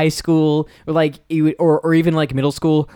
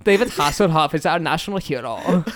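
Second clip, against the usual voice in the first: proud fake-German declaration; mock German accent. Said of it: "the crew was mostly German".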